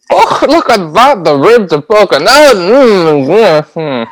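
high, slow fussing bubbe voice; hammed-up Yiddish grandma voice. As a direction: play a kvetching old grandmother. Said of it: "I would probably say".

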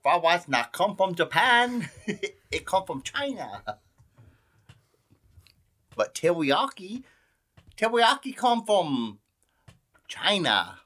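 high, laughing mock Scottish accent, broken English. Cohost mocking fried rice facts in a Scottish accent. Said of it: "I might do a little Scottish accent".

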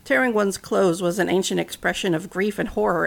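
ordinary delivery, nothing marked

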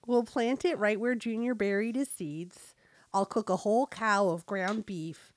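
chatty Fargo-style North Dakota mom; North Dakota accent. Acted. Mom chatting about Junior's birthday, North Dakota accent. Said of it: "read with a North Dakota accent".

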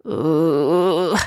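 long, strained groan. A narrator's reading. Turtle groans under the girl sitting on him. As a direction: groan under a heavy weight. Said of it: "groaned the voice".

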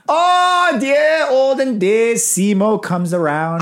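put-on high Old English flourish, dropping back down; slow, hammed-up Old English storyteller. Shown as a demonstration. Host showing friend the Shakespeare style, hammed-up Old English. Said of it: "Old English".

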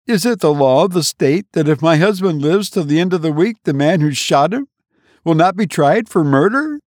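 high, worried woman's voice; a wife fretting over her dying husband; anxious wife. A narrator's reading. Wife answers her brother, high and worried.

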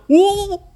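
very high loud yelp; startled whoa. A narrator's reading. Pirates losing their pants, high startled yelp. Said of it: "they all went".

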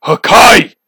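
loud, high battle cry; shouted catchphrase. Pretend. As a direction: bellow it like an anime attack. Anime catchphrase shouted in banter.

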